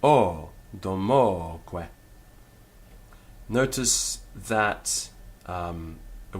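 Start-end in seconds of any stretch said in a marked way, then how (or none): 0.0-1.9 a long o, then "domoque" slowly with mo stressed; accent on O. Shown as a demonstration. Teacher pointing out the accent, "domoque" stressed on the o. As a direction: hold and stress the middle o of "domoque". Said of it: "the accent there falls on the".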